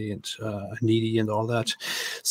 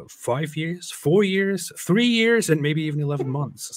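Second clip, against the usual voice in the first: building higher to incredulous; each age higher and more shocked; rising mock disbelief; 5 semitones higher, 4 dB louder than usual. Mock-incredulous escalating list.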